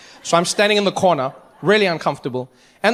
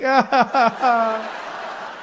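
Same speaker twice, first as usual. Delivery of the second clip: cackling laugh; 3 semitones higher than usual. Retold or imitated. Laugh in grandmother voice.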